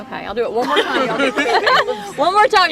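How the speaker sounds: talking loudly through laughter; laughing. Real, not acted. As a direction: laugh through the words, voice rising.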